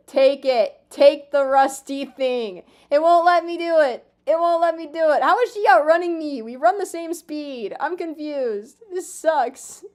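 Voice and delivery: loud, high exasperated yells; real gamer frustration, loud and high; frustrated yelling. Real, not acted. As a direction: yell at the game in frustration. Frustrated at fleeing villager.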